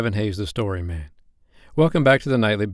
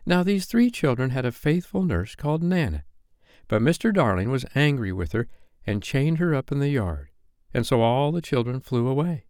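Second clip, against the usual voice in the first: higher storytelling voice of Wendy; Wendy telling a story; 5 semitones higher than usual. Acted. Wendy telling the boys a story, storytelling. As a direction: narrate to children in a raised pitch.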